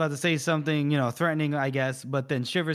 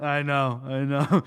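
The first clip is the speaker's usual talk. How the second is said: ordinary talk, nothing marked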